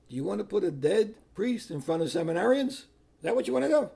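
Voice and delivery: retold defiant challenge; challenging retort. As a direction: throw it back at him, pitch climbing. Retorts to the screaming cardinal.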